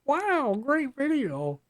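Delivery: very high, laughing sarcastic praise; squeaky mock voice. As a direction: squeak it out mockingly. Repeats the sign-off, even higher.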